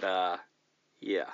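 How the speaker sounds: ordinary delivery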